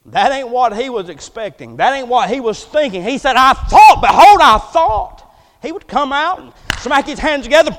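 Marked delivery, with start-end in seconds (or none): 3.4-5.3 loud, emphatic acted protest; indignant declaration. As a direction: proclaim it loudly, stretching the last words. Preacher voices Naaman's indignant words.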